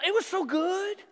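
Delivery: grumbling Israelites, whiny and high; mocking nostalgia. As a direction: whine it like spoiled complainers.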